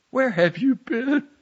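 high, slow, trembling demand; shaky, frightened demand. A narrator's reading. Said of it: "voice trembling".

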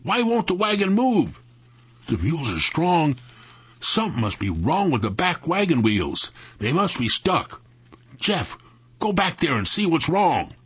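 high, worked-up puzzlement; exclaiming. A narrator's reading. Sam puzzled by stuck wagon, exclaiming to Jeff. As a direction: exclaim it with rising urgency. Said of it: "Sam exclaimed".

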